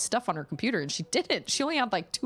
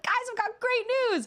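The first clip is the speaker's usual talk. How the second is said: high, thrilled announcement; excited turtle. Retold or imitated. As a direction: burst in with good news. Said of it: "he's like".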